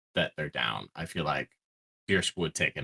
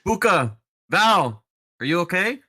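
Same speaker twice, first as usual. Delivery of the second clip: high, loud shout to fallen friends; calling out; 14 semitones higher, 9 dB louder, slower than usual. Acted. Player calls out to downed companions Buka and Val, calling out. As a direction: shout their names, pitched up to carry. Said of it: "calling out to just".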